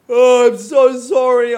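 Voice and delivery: talking through a yawn. Real, not acted. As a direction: apologise mid-yawn, stretched. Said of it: "I'm yawning".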